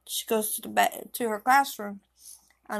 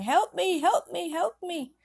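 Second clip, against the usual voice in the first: mock yell; 9 semitones higher than usual. A narrator's reading. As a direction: plead for help in a restrained, high yell. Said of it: "she's yelling".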